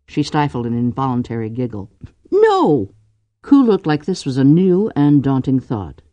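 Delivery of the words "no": very high, loud no; startled denial. A narrator's reading. Drew hasn't complained to her brothers, startled denial. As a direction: yelp the word, half amused.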